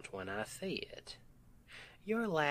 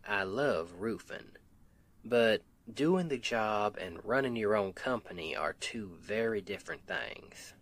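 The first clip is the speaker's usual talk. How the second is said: usual speaking voice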